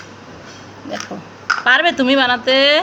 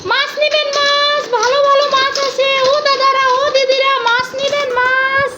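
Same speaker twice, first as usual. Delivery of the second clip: higher, slow sing-song hawker call; fish seller call; 5 semitones higher than usual. Pretend. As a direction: cry out your fish to the street. Mother plays street fish seller.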